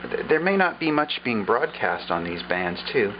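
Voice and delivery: usual speaking voice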